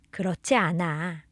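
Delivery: ordinary delivery, nothing marked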